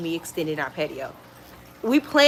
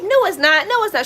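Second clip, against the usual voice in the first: dismissive April; 10 semitones higher, 4 dB louder than usual. Retold or imitated. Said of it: "It's like".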